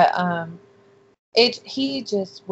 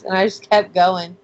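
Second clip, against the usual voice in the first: ordinary talk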